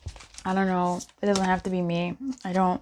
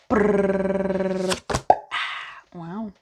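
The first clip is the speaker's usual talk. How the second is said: usual speaking voice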